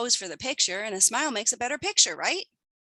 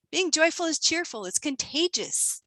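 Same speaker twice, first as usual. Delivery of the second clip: ordinary talk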